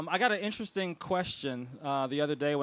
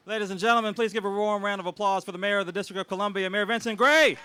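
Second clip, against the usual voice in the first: announcer intro; 5 semitones higher, 5 dB louder than usual. Acted. The host announces the celebrity guest to the crowd in a big MC call. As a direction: sell the big name like a ring announcer.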